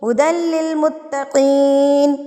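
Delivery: slow, measured Quran recitation; resume at هُدًى and draw out لِّلْمُتَّقِينَ; recitation after the stop. Shown as a demonstration. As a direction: recite slowly, long held final syllable. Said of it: "فی ہی پہ وقف کریں گے تو کیسے پڑھیں گے".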